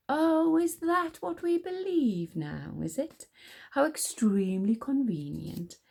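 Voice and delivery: mocking, drawn-out disbelief; sceptical. A narrator's reading. Encanso doubting the queen's excuse, sarcastic. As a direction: start high and slow, then sink drily. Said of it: "raising an eyebrow".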